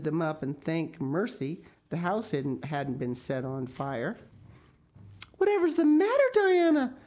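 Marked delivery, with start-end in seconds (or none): 5.4-7.0 high, loud startled call; alarmed cry. A narrator's reading. Anne at the door crying out to Diana, high and alarmed. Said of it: "cried Anne".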